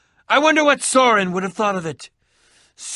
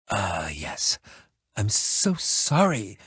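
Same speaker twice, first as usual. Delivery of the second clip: ordinary talk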